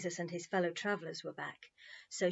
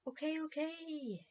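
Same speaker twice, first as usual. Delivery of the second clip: soothing murmur; 9 semitones higher, 6 dB quieter, slower than usual. A narrator's reading. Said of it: "his friends murmured soothingly".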